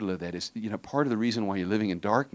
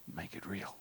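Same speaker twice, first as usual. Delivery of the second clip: soft whisper, urging; whispered plea; 3 semitones lower, 14 dB quieter than usual. Real, not acted. Preacher urging listeners in a whisper. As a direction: breathe it to the congregation.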